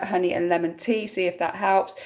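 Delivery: ordinary talk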